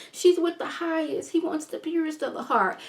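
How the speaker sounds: a hint of a brainwashed follower; entranced cult member. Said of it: "she kinda sound like".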